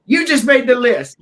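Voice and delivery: loud, high wrestler catchphrase; Jericho. Retold or imitated. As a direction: belt out a mock promo line. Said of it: "pulled the pin out and goes".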